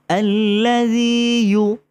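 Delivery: ইউ drawn out slowly like English letter U; stretched ইউ. Shown as a demonstration. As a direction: sing ইউ long as the letter U. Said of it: "ইউ শব্দটা আছে ইংলিশে". (ইউ টেনে ইংরেজি U-এর মতো)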